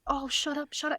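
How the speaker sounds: hushed, high self-scolding; mock panicked telling-off; screaming inwardly. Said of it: "I'm screaming at myself".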